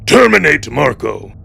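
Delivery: usual speaking voice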